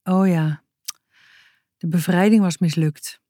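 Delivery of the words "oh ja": plain reading voice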